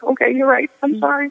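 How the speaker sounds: small, high apologetic voice; meek giving in. Retold or imitated. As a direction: concede meekly, pitched well up. Retold giving in to husband.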